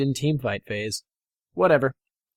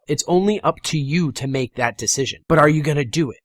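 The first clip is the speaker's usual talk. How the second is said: ordinary delivery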